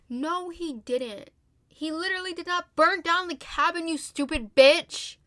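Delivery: loud, high, furious rebuttal; heated outburst. Real, not acted. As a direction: lose your cool and shout it. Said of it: "She had me heated".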